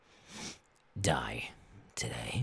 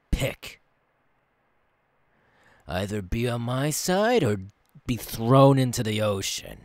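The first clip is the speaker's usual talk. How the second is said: ordinary delivery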